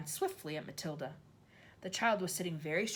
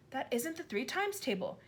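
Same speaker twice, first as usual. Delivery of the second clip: higher, anxious protest; a scared boy pleading; frightened cry; 7 semitones higher than usual. A narrator's reading. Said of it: "Wilfred cried".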